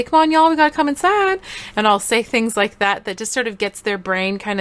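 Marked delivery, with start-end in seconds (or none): 0.0-1.4 high, playful Texan call; Texan mom calling out; Texan accent. Shown as a demonstration. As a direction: call the kids in with a Texan twang. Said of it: "a Texan accent and I'll say".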